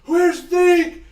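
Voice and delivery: loud, high call for Fink; high shouted search. Acted. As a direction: call across the town. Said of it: "he goes".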